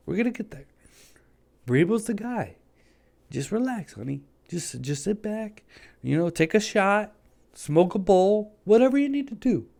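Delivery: mock soothing talk. Imagined friend calming the team owner, high mock-soothing. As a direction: pretend to calm a boss gently, honey. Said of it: "nobody sat her down and was like".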